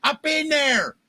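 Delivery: hammed-up Baltimore radio voice; Baltimore accent. Host on the radio mocking a caller in a thick Baltimore accent. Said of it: "I'm doing this accent".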